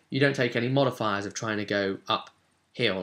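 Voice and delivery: usual speaking voice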